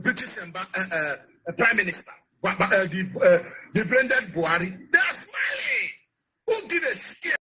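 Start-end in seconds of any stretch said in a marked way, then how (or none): 4.9-7.4 indignant shout. Real, not acted. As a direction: yell it out in disbelief. Outraged exclamation.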